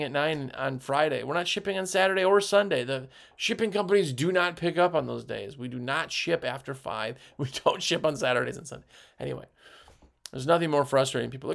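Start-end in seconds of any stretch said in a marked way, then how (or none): none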